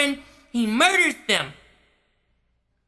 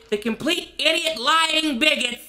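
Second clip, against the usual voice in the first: usual speaking voice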